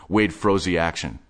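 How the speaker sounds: ordinary delivery, nothing marked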